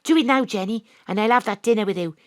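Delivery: ordinary talk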